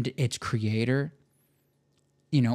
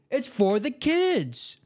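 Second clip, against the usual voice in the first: mock tearful; 9 semitones higher, much slower than usual.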